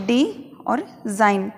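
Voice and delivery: डी और जाइन slowly split, g silent; syllable by syllable. Shown as a demonstration. Teacher slowly breaks डिज़ाइन into syllables, g silent. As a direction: spell out डिज़ाइन slowly in parts. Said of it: "जी silent हो जाता है".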